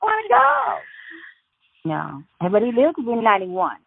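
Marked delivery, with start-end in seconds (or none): 0.0-0.7 much higher, joking ghost echo; mock ghostly squeak. Granddaughter echoing the joke, much higher mock ghost voice. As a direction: echo it way up high like a silly ghost.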